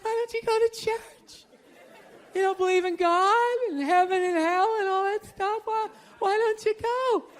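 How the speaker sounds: high, whiny put-on girlfriend voice; nagging girlfriend. Girlfriend nagging him about church.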